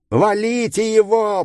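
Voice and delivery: high shouted order. A narrator's reading. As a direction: yell it to attack. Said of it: "прокричал один из них".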